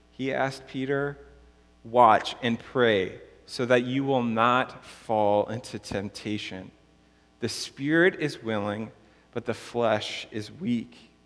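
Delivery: measured, emphatic pulpit reading; scripture reading. Real, not acted. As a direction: read the Gospel out solemnly to the congregation, stretching key phrases. Reads the Gospel passage aloud.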